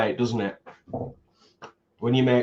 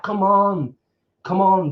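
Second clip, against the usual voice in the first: raised, pleading mock appeal; exasperated plea; 8 semitones higher, 5 dB louder than usual. Viewer let down by horror films, raised exasperated plea. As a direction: urge it on, higher and louder.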